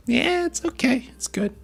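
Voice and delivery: very high, noncommittal mock voice; lukewarm verdict. Streamer mimics so-so reaction to other noodles, high and lukewarm.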